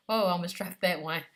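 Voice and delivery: laughing in surprise after a near drop; gasp, then laugh it off; startled laugh. Real, not acted. Nearly drops the putty.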